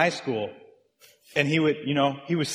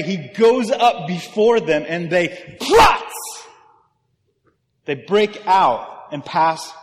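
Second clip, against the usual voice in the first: louder climax on "prats!"; loud preaching; 3 semitones higher, 7 dB louder, slower than usual. Acted. Pastor building to the verse's climax, loud. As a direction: drive it home loud.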